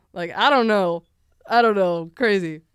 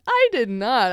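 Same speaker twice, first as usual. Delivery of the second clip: louder, higher retold protest; mock indignant denial; 4 semitones higher, 8 dB louder, slower than usual. Accused pupil answering the tattler, mock indignant. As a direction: push back hard, as if wrongly accused.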